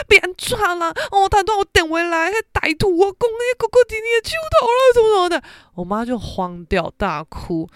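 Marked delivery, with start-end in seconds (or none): 0.1-5.4 high, fast mock panic from a frightened mother; panicked mom. Mom panicking on the phone to dad after the scam call, high frantic rush. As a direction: rattle it off high and breathless, like a terrified mother. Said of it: "我妈吓爆了".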